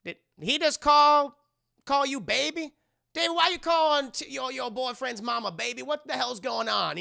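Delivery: high, loud incredulous questioning; mock shock. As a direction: demand answers as a stunned onlooker, hammed up. Voices everyone's shock at the word.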